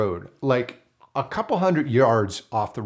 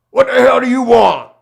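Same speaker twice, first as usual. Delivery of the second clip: much higher and louder, hostile bark; angry shout; 10 semitones higher, 13 dB louder than usual. Retold or imitated. Greasy recluse confronting the stranger, angry shout. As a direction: yell at the intruder on your porch. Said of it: "he looks at me and he goes".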